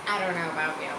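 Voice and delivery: ordinary delivery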